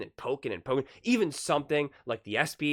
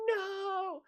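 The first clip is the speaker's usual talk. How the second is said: high, far-off squeaky mock scream; 3 dB quieter than usual. Retelling the Austin Powers steamroller scene, mock scream. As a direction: squeal it like a tiny figure far away. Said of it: "He's like".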